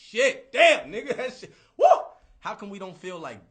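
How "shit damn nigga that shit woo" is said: loud laughing mock holler; mock excited hollering. A guy gawking at elbows hollers with laughter. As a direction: whoop it up with a laugh.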